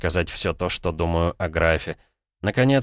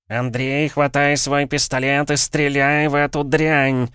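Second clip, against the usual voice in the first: high, slow, urgent cry; mental yell; 8 semitones higher, 3 dB louder, slower than usual. A narrator's reading. Cat Savely urging Andrey to shoot, high-pitched yell. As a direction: cry out the order in a high character voice. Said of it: "Раздавшийся вопль в моей голове".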